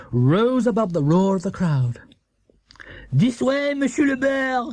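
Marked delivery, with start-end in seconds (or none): 3.1-4.7 put-on high French-accented call; Monsieur Dupont crying out. A narrator's reading. Monsieur Dupont guiding Paddington to the shop gates, crying out. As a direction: call him through the gates. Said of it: "he cried".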